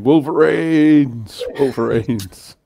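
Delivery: drawn-out mock battle cry; imitating shouting. Recalls film fans running around shouting, long imitated yell. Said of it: "people running around shouting".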